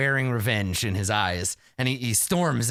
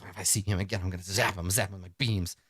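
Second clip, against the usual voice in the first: softer, lower muttered grumble; muttering old man; 5 semitones lower, 5 dB quieter than usual. Acted. Aged inventor mumbling revenge lost in memory, muttering. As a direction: mumble it to yourself.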